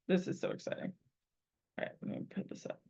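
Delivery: whisper. Real, not acted. Excited aside at the end.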